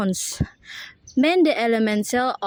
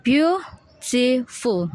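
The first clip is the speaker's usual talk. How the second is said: "beautiful" slowly split as beau, ti, ful; syllable by syllable; much slower than usual. Shown as a demonstration. Student showing the syllables, "beautiful" slow and separated. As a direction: pause between all three syllables of "beautiful". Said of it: "the syllables are".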